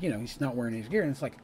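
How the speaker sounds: ordinary talk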